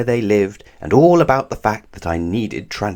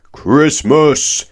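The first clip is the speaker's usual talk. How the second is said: big booming call, higher and louder; booming giant; 7 semitones higher, 7 dB louder than usual. Acted. Giant wizard booms a greeting to Christmas. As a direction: boom it like a giant. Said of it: "he boomed in a voice".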